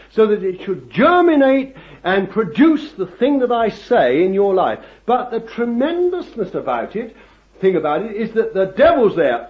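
loud, high-pitched sermon delivery; ringing pulpit proclamation; impassioned preaching. Real, not acted. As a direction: preach it at full force, punching the key words. Preaches at full force.

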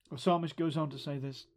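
ordinary delivery

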